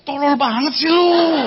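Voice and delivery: slow, drawn-out mocking jab; mock insult. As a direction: sneer it at a slow pupil. (ejekan pelan yang dibuat-buat)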